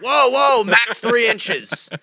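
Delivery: high, loud, drawn-out shout; mock alarmed cop. Imitating cop limiting the window gap, alarmed shout. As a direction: halt them with exaggerated alarm.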